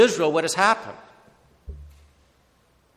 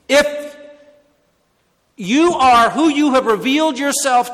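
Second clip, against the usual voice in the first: eager plea; 7 semitones higher, 8 dB louder than usual. Acted. Peter voiced pleading with Jesus.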